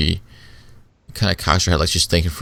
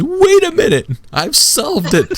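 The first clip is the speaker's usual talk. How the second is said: very high, loud, drawn-out eureka outburst; hammed-up triumphant announcement; Darvish's sudden proclamation; 5 dB louder, slower than usual. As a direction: burst out as if you cracked the case, pitched way up. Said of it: "finally Darvish snaps out and says".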